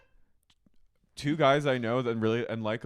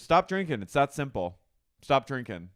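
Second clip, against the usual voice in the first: loud mock scolding; Jax; 2 semitones higher, 7 dB louder than usual. Husband tells sick wife to quit, loud scolding. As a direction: lay down the law, lightly imitated. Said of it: "he goes".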